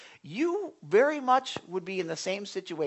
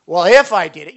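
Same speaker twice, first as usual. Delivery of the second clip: loud, defensive "if I did it"; mock defensive excuse; evasive excuse; 16 dB louder than usual. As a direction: dodge the blame out loud. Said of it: "you're not taking responsibility for it".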